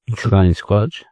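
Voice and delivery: plain reading voice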